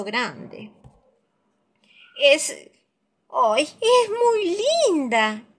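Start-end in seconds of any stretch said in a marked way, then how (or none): 2.2-5.4 high, slow amazed little bear; delighted exclamation. Acted. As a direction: gasp of wonder at the moon. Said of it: "exclama Leila".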